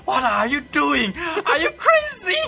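very high, loud, hammed-up cry; disbelieving shriek. A narrator's reading. Emperor seeing an attacker charge, high disbelieving shriek. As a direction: shriek each question in comic outrage. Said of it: "他不敢相信自己的眼睛".

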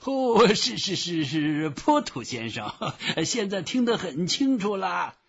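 high, stammering clerk voice; high-pitched apologetic official; flustered phone clerk. A narrator's reading. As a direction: stammer a hasty placating agreement. Flustered clerk after being roared at.

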